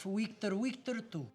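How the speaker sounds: Victor said as Wictor, a W in place of the V both times; Russian accent with the V of Victor turned into a W. Acted. Russian ensign gives his authorization code, saying Victor as Wictor. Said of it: "had a real issue with the W's".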